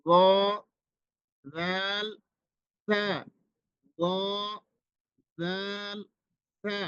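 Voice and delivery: গ, জাল, তা each soft and light, tongue tip on the teeth; soft letter sounds. Shown as a demonstration. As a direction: model the three soft letters slowly. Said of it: "একেবারে নরম উচ্চারণ".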